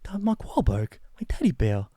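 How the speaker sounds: put-on high Boston-accented voice dropping away at the end; Mark Wahlberg. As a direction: parody a Bostonian, thinning to near-whisper. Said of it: "like, Mark Wahlberg's like".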